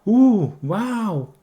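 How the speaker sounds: high, slow, wide-eyed wow; drawn-out delighted awe; amazed exclamation. Shown as a demonstration. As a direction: gush over something incredible. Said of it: "entonação maior".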